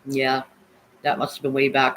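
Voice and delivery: normal talking voice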